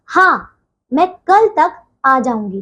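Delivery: "हाँ" on its own, short pause, then the rest; comma pause after हाँ. Shown as a demonstration. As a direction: halt briefly after "हाँ" before "मैं कल तक आ जाऊंगी". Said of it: "जहां थोड़ी देर ठहरना हो".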